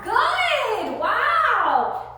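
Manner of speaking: high, loud delighted praise; excited praise. Real, not acted. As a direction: burst out in delight at the dog. Praising dog's step back.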